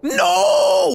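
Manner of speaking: very high, loud cry; anguished shout. Real, not acted. Gamer screaming as he gets eliminated. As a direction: scream no as you lose.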